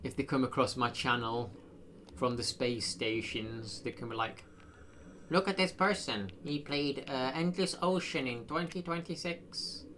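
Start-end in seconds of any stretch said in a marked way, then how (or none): 5.3-9.8 lightly voiced alien; a hint of an alien visitor. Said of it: "That's meant to be an alien voice".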